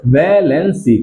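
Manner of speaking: "Valency" slowly, broken into syllables. Shown as a demonstration. Teacher drilling the class on the term, slow and syllabic "valency". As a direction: draw out each syllable of "valency".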